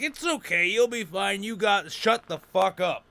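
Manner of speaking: put-on high, breezy old stoner telling him to relax; mock reassuring codger. Guy reassures him, then snaps.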